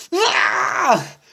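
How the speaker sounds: sudden shout of alarm; startled cry. Acted. Gerald crying out at the flies. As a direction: jump back in fright.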